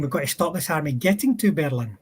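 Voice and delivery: ordinary talk, nothing marked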